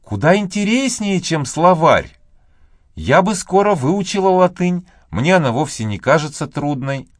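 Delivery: put-on eager little girl, boasting lightly; young girl voice. A narrator's reading. Maggie voiced by narrator.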